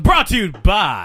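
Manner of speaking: loud, slow sponsor read; hammed-up announcer voice; mock announcer.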